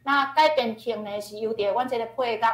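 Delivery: ordinary delivery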